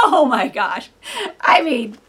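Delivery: loud laugh into oh my gosh; laughing delight. Real, not acted. Preacher delighted, laughing aloud. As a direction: burst out laughing with joy.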